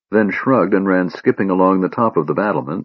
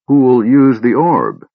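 slow, weighty ask, a bit louder; slow question; 5 semitones higher, 5 dB louder, slower than usual. A narrator's reading. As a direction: drag each word out carefully. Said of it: "Sturm asked slowly".

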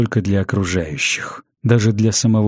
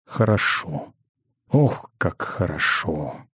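soft, slow sigh of pleasure; blissful relief; 3 semitones lower, 6 dB quieter, slower than usual. A narrator's reading. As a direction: savour the relief, letting the voice sink. Savouring relief after the drink. (с тихим блаженством, на выдохе)

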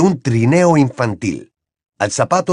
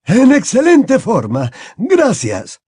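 put-on higher, slow, courtly old wizard; a raised, courteous headmaster; Dumbledore voiced; 11 semitones higher, 4 dB louder, slower than usual. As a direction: greet a grand guest, slow and raised.

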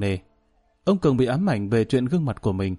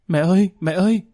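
high, loud cry for mum; sudden child's shriek; 7 semitones higher, 5 dB louder than usual. A narrator's reading. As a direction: cry out like a frightened small child, pitched up. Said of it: "bỗng rú lên". (bỗng rú lên gọi mẹ, giọng cao và to)

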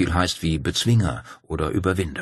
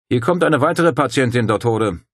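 called out higher and louder; calling out; 6 semitones higher, 6 dB louder than usual. Acted. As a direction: call across the camp to the doctor. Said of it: "rief Dario".

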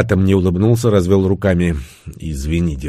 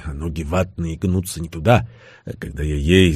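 plain reading voice

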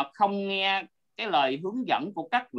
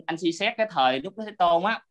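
usual speaking voice

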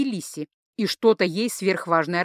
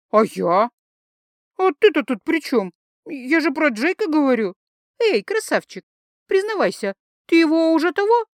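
put-on higher, louder tipsy girl voice; drunk teasing; 7 semitones higher, 4 dB louder than usual. Drunk Lisi teases Jake, high and loud.

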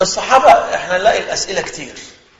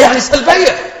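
impatient mock yell, higher and louder; angry teacher; 8 semitones higher, 6 dB louder than usual. Teacher berating the student in theology class, exasperated yell. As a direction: imitate a teacher losing patience. Teacher repeats non-answer.

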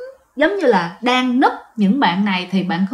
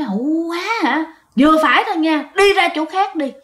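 mock a friend telling someone off, pitched up; retold angry yell; 5 semitones higher than usual. Friend yelling at an unseen teaser to go away, retold angry outburst. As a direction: imitate a fed-up yell, high-pitched. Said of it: "có một bạn la lên".

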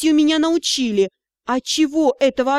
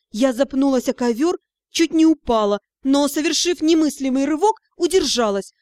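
ordinary delivery

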